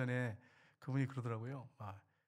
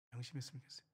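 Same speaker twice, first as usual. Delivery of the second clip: 명심했으면 좋겠어요 repeated much softer, almost to himself; soft echo; 3 semitones lower, 13 dB quieter, faster than usual. Real, not acted. Preacher gently repeating his wish to the congregation, soft echo. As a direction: echo it quietly, letting it sink in.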